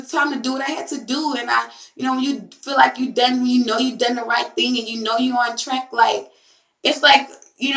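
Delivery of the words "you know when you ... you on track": usual speaking voice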